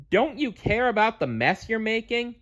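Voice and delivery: high scolding question; asking. A narrator's reading. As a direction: press her friend for an answer. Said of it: "she asked Messy Bear".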